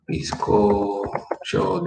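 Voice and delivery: "bizcocho" stretched slowly, falling at the end; slow drawn-out word. Shown as a demonstration. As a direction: draw out "bizcocho" for the students. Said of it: "some people may say el bizcocho".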